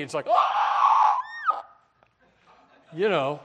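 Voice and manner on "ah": high unvoiced gasp of shock; a hammed-up cry of horror; mock horrified cry. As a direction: gasp as if the world ended. Congregants aghast at no tradition.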